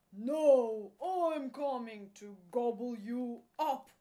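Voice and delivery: loud, menacing troll; troll threat. A narrator's reading. Troll threatening to gobble up the little goat, loud menace. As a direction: boom out the threat as a hungry troll. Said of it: "said the troll".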